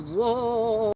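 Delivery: lightly imitated cartoon cowboy whoa; a mild take on the cartoon cowboy's whoa; Yosemite Sam. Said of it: "He would always do that".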